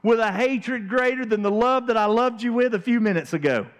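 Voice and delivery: preacher mocking Amnon's lovesick whining; whiny lovesick Amnon. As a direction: play him as a sulking spoiled prince. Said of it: "looks at her and says".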